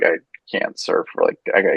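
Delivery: low, casual retold quote; nonchalant. Friend retelling an injured surfer bowing out, nonchalant. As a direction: play it down, low and offhand. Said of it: "Very nonchalant about it".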